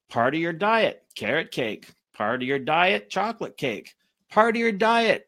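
ordinary delivery

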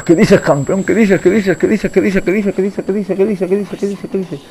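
high rapid-fire repetition, starting loud and fading; frantic panic. Real, not acted. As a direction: gabble it over and over in panic. Frantic repeating while chased. (repetición aguda, atropellada y nerviosa)

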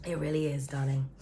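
low, husky, sultry purr; sexy deep voice. Real, not acted. Tarot reader to Libras, in a sultry low voice. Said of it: "my voice is kinda sounding a bit sexy".

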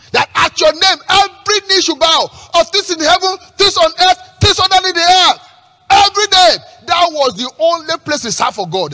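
high, loud pulpit proclamation; ringing, declamatory sermon shout; preach. Acted. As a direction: build phrase on phrase at full preaching force. Preaches at full force.

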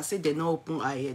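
ordinary talk, nothing marked

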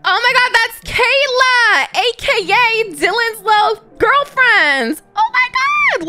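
high, loud squeal of recognition; excited gasp. Real, not acted. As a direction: gasp and squeal it like you just spotted a celebrity. Spots Dylan's girlfriend in game.

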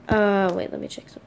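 usual speaking voice

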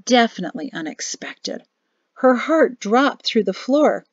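ordinary delivery

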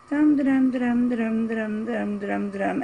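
hummed dum da-dum, fading away; bored humming. Retold or imitated. Kid in the timeout chair humming, bored and fading. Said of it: "be like".

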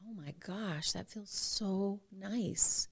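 slow, soft, almost whispered delight; a soft sigh of relief; hushed wonder. Retold or imitated. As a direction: savour it quietly, amazed. Remembered thought at touch.

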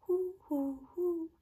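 high loud owl hoots. Acted. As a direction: hoot like an owl. Owl sound.

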